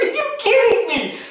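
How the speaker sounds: high, incredulous outburst; laughing disbelief. Retold or imitated. Dad reacting to his son's reason, laughing incredulously.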